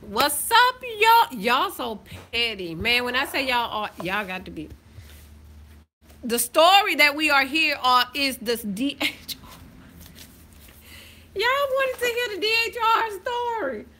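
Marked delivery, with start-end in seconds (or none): 0.1-1.4 much higher, louder hello; big excited shout-out; loud hype greeting. Real, not acted. Loud high greeting to viewers. 11.3-13.9 mock high voice. High mock voice teasing viewers.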